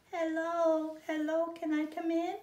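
high, small birdlike voice; a tiny high chirping baby bird; little bird voice. Acted.

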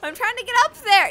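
high, loud, fast yell; exasperated retort. Real, not acted. Fumbling player defending themself to a partner, exasperated fast yell. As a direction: yell back defensively, in a rush.

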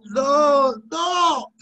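high shouted no, almost crying; real exasperated cry; despairing cry. Real, not acted. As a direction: wail it out loud.

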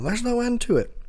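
high-pitched mock panic; retold cry of alarm; freaking out. Reviewer voices people in the exploding roof, mock freaking out.

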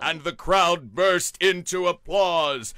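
rousing storyteller's finale; climactic announcement. A narrator's reading. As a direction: proclaim the big finish.

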